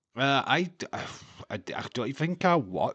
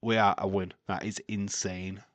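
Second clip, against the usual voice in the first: ordinary talk, nothing marked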